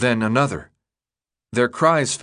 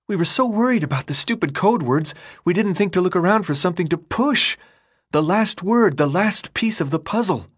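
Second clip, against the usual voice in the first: put-on higher girl's voice, breathless with relief; Teresa's voice; 8 semitones higher than usual. A narrator's reading. Teresa tells the boys they found the last word, voiced higher and excited. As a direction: let Teresa gush with happy relief.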